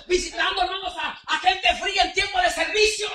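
loud, high, driving sermon; preaching at full force. Acted. Preacher rebuking lukewarm believers, full-force preaching. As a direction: exhort them to come to church.

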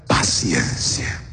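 very low, drawn-out "paciencia"; dramatic low drop. Real, not acted. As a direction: let "paciencia" sink slow and deep. Drops the punchline word.

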